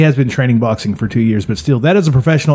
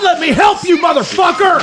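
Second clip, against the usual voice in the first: shouting. Acted. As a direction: scream it at the kid. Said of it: "I said".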